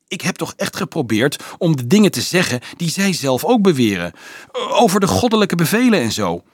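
high, loud, earnest self-defence; apologetic excuse. A narrator's reading. Dolf excusing his heretical talk to the student, apologetic. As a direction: protest that you honestly tried. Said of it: "verontschuldigde hij zich".

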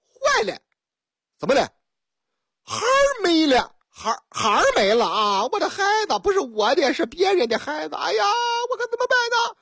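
put-on high, wailing cry that the kid is gone; hammed-up panic of a frantic mom; mock wail over a lost child; panicked mother.

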